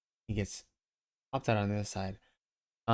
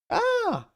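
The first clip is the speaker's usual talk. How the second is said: shrill startled woman's shriek; high falsetto woman's scream; lady's high scream; 5 dB louder than usual. Acted. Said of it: "a lady yells in a towel".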